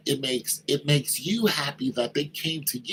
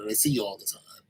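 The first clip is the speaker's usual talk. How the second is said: ordinary delivery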